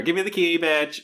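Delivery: loud, high reality-show demand; Janelle. Retold or imitated. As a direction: demand it like a brash reality-TV contestant. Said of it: "very much like Janelle and Big Brother".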